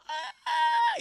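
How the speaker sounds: very high squealing shriek; cartoon death scream. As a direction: squeal as he gets crushed. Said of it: "Elmer Fudd's all".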